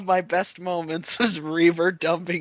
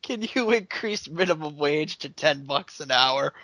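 ordinary talk, nothing marked

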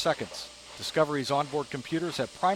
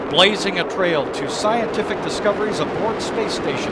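rising, louder launch call; excited countdown; 3 semitones higher, 6 dB louder than usual. Real, not acted. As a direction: build up to liftoff. Launch commentator calls liftoff.

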